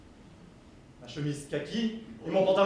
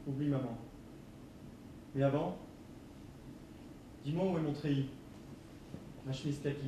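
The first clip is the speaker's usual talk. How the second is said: soft pleading; 5 semitones lower, 13 dB quieter than usual. Acted. Son softly pleads for clothes.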